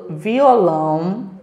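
"violão" stretched slowly, vi-o-lão; slow syllable demo. Shown as a demonstration. Teacher models "violão" slowly for the children, syllable by syllable. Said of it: "algumas palavrinhas com a família silábica".

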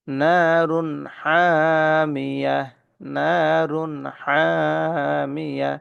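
নারুন হামিয়া very slow, tanwin nun clear before হামিয়া; drawn-out tajweed recitation. Shown as a demonstration. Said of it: "তানবিনের পরে হা আসছে।".

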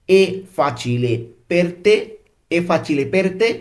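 careful, drawn-out language-lesson question; slow model reading. Shown as a demonstration. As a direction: say it slowly for learners, lifting on "te". Teacher models Italian question.

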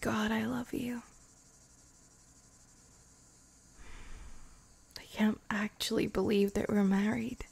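soft unvoiced whisper, close to the ear; tender whispered confession; intimate whisper. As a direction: confess it in a hushed breath. Whispered wonder at being married.

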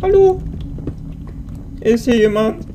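calling out. Real, not acted. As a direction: call out, unsure if anyone's there. Calling out alone in a cave.

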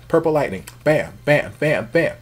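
mock lightning zaps. Mimicking lightning strikes.

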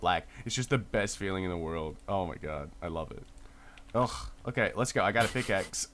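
ordinary talk

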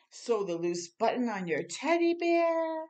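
plain reading voice

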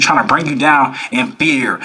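ordinary talk, nothing marked

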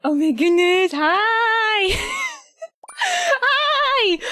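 high, squealed hello; excited greeting. Real, not acted. Nervous streamer greeting the crowd, excited squeal. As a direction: gush with nervous delight.

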